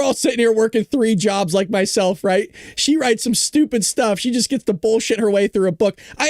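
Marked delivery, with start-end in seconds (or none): none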